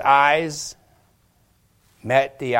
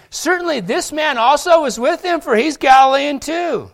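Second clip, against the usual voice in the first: high, loud accusation; insistent accuser; 7 dB louder than usual. Acted. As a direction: insist hard that he was there. Another man insists loudly.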